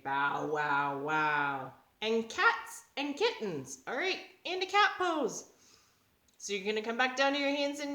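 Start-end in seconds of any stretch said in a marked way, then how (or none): 0.0-1.7 lightly voiced deep dog; bigger dog bark. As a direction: lower mock bow wow. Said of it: "Some other dogs say".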